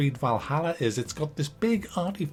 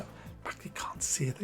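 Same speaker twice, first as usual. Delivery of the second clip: hushed self-scolding aside; whisper; 12 dB quieter than usual. Pretend. Whispering to himself that he can't say that. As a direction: whisper a warning to yourself, unvoiced.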